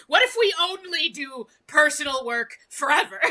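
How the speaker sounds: loud, eager brain voice; excited shout. Retold or imitated. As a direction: shout it like a giddy idea.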